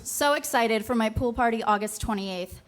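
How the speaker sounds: ordinary delivery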